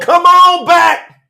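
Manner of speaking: high, slow drawn call; yelling out. Acted. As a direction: yell up to God, stretching it. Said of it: "we yell out to Yahuwah".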